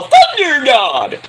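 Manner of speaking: loud, high, drawn-out holler; theatrical bellow. Acted. Host calling out Thunder God, long theatrical shout. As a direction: bellow it slowly like a ring announcer.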